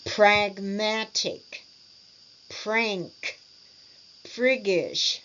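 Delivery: each word separate, with popped P and B, sharp K, throaty G; exaggerated articulation. Shown as a demonstration. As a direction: exaggerate every consonant and vowel, one word at a time. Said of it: "notice all the different ways to exaggerate".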